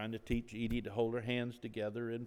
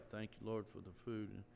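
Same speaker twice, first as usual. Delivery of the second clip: a man quietly mumbling grace over his food; mumbled prayer; 2 semitones lower, 6 dB quieter than usual. Retold or imitated. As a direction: murmur the grace into your plate. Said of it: "you're mumbling".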